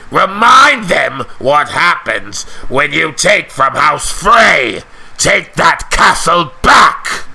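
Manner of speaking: slow, drawn-out delivery with sudden shouts; cantankerous lord. Acted. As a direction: relish each word, then bellow the order.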